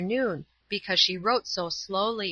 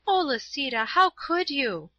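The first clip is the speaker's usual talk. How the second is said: dismayed cry; 7 semitones higher than usual. A narrator's reading. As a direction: draw it out in dismay, pitched high. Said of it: "cried poor dismayed Paula".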